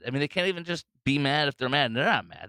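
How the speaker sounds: ordinary talk, nothing marked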